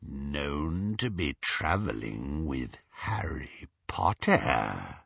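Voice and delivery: low, slow, whispery villain; quiet menace. A narrator's reading. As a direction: repeat it softly as realization dawns, deep and drawn out. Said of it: "repeated Greyback quietly".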